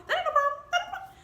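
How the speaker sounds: high-pitched, excited squeal; squeaky high. Retold or imitated. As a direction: pitch climbing with glee. Eager repeat getting higher.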